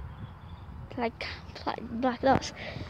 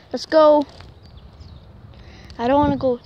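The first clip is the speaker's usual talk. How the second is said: loud urging; 2 semitones higher, 10 dB louder than usual. Real, not acted. Urging dog loudly.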